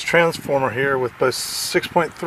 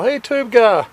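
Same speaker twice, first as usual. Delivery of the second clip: imitating a man's higher, louder call; imitated yell; 9 semitones higher, 6 dB louder than usual. Camper hailing the tube buyer driving by, imitated yell. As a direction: call out high like hailing someone passing. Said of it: "I hear some guy yell".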